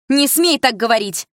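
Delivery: high loud furious command; angry shout. A narrator's reading. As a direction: yell it to stop her cold. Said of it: "закричала мама ещё громче". (мама кричит на дочь в ярости)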